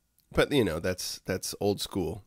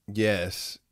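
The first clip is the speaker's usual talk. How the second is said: usual speaking voice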